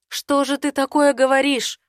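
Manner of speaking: can't believe what she hears; shocked heroine. Acted.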